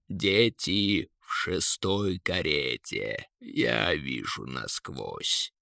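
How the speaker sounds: slow, deep eagle; eagle's voice. A narrator's reading. Eagle reports what it sees. (низко и протяжно, голосом орла)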